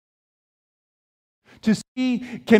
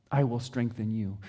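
soft repeated promise; 9 semitones lower, 7 dB quieter than usual. Acted. God's promise, repeated softly.

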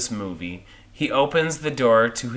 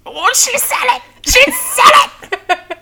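very high squeal; high-pitched gleeful cheer breaking into laughter; mock giddy excitement; 6 dB louder, slower than usual. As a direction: squeal it like an overexcited fan, far above your voice. Excited reaction to title being said.

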